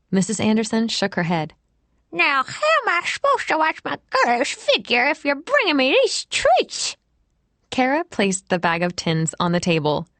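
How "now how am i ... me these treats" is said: put-on high, fussy old lady voice; fussy old woman. A narrator's reading. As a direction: fret like a prim nursing-home lady. Same old woman character.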